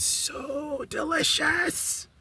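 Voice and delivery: high, drawn-out squeal of delight; soft, stretched sing-song swoon in a very high voice; gushing delight. Real, not acted. Telling viewers about her curry chicken, gushing. Gushing over the meal she cooked.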